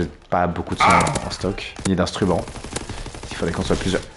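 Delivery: ordinary talk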